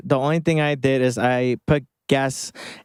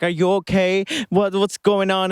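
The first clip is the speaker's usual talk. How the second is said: higher, mildly worried mom; retold freaking-out mom; 6 semitones higher than usual. Mom on the phone to her shaken kid, mock worry. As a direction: imitate a mom starting to panic. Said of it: "She's, like, freaking out".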